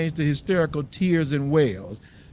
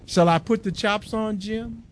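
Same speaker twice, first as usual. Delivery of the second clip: higher, hopeful wife voice; young wife asking, a touch higher; wife's gentle question; 7 semitones higher than usual. A narrator's reading. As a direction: offer supper lightly.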